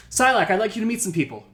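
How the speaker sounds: calling out. Acted. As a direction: call out brightly to introduce someone. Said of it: "you hear a voice call out".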